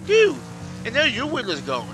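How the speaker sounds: high, loud protest; exasperated yell. Real, not acted. Racer to the one hitting him, exasperated yell. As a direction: cry out at the other driver. Hit again during race.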